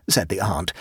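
ordinary delivery, nothing marked

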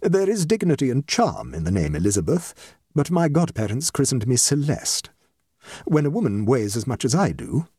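lightly voiced composed guest; dignified guest. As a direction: explain as a mildly rueful society lady.

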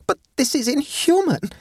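high-pitched tragic protest; tragic exclamation. A narrator's reading. Mistress protesting to her striking maid, tragic exclamation. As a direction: exclaim it as a tragedy. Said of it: "exclaimed Sophie tragically".

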